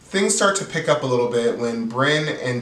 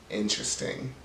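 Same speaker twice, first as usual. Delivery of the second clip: sly whisper; 2 semitones lower, 9 dB quieter than usual. Real, not acted. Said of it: "Very interesting".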